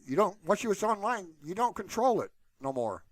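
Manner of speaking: normal talking voice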